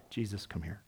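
quiet, confidential pull-aside; hushed aside. Retold or imitated. Peter pulling Jesus aside, hushed and urgent. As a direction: murmur it like a friend taking him aside. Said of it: "Then Peter pulls him aside".

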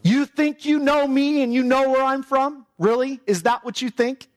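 scoffing, ironic retort. Shown as a demonstration. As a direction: throw it back at them with disbelief. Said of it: "he's using irony".

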